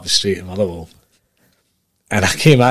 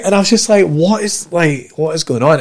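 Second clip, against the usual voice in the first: high, loud excited storytelling; excited retelling; 9 semitones higher, 5 dB louder than usual. Real, not acted. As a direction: tell it in rising excitement. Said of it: "Oh, mate".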